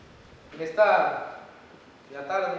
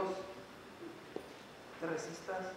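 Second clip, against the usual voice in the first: slow whisper, pleading; a near-silent, slow appeal; whispered plea; 14 dB quieter, much slower than usual. Real, not acted. Preacher pleads softly with an unbelieving friend, a slow whisper.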